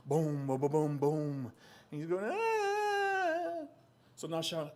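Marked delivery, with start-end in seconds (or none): 0.1-1.5 rhythmic booming stomps; imitated drumbeats. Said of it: "it sounded like drums". 2.2-3.7 long, high-pitched wail; mock scream. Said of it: "decided to scream even louder".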